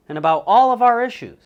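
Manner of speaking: high, loud announcer delivery; show host intro. Acted. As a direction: open the show big and high.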